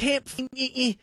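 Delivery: caller-show host imitating Dabo stammering; mocking Dabo. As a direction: sputter it like a caught-out coach. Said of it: "That's Dabo".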